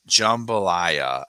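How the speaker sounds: drawn-out jambalaya. Real, not acted. Savoring the dish name.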